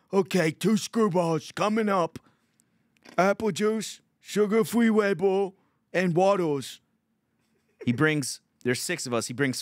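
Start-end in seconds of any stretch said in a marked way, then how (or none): 0.1-6.8 high, thick-tongued mock waiter voice; waiter impression. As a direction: imitate the waiter slowly repeating an order. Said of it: "And Lenny's like".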